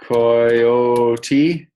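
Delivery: "Coyote" stretched very long and loud; drawn-out word. Shown as a demonstration. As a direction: stretch each syllable of "Coyote" slowly. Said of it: "see how to spell those names".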